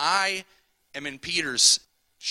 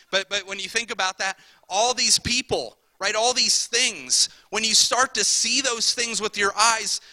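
rising preaching; 4 semitones higher than usual. Acted. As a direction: lift it up like a pastor rallying the church. Pastor builds to raised preaching.

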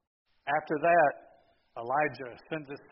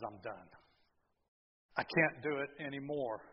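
defeated surrender; 11 dB quieter than usual. Acted. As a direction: let the words fall, exhausted. Elijah gives up in the cave.

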